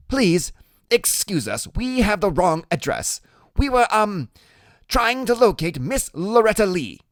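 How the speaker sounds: put-on over-polite kid excusing himself, high-pitched; polite, nervous Freak. A narrator's reading. Freak excusing himself to the gang couple, high and nervously polite.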